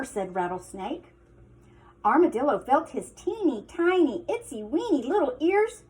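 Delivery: ordinary delivery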